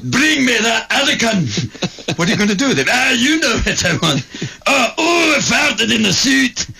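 Fat Bastard. Retold or imitated. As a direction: bellow it greedy and crude, Scottish accent. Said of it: "Fat bastard".

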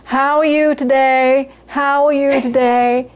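slow, droning rote greeting; bag packer. Acted. Guy packing groceries greets customers without listening, slow automatic drone. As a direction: stretch each word out on one note, automatically. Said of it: "the guy who's packing goes".

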